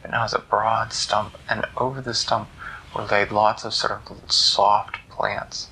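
usual speaking voice

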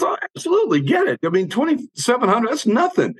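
high, breezy imitation of his friend; friend Bob, casual. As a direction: toss it off lightly, pitched well up. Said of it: "He says".

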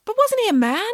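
put-on high-pitched child's objection; interrupting boy. A narrator's reading. Boy doubting nanny's claim about Aesop, interrupting. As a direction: cut in with a puzzled high question. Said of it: "interrupted Michael".

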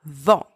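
"Vent" with a nasal French vowel, no t; ENT as nasal vowel. Shown as a demonstration. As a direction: model "Vent" like "von", n unsounded. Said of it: "are pronounced en".

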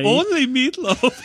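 slow, high mock German accent through laughter. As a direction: chime in as the comic German, laughing. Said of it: "that was a great German accent".

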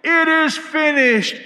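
high, loud, slow triumphant yell; shouted proclamation. Retold or imitated. Next runner passing the cry along, loud yell. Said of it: "the next one would yell".

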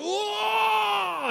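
very high, sustained mock yell; mock flexing roar. Last guy flexing his side, hammed-up high roar. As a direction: hold a strained bodybuilder's whoa.

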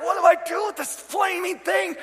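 high-pitched frantic question; hammed-up alarm, voice shooting up; mock panic. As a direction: wail it as if holding a bomb. Retold panic holding burning can.

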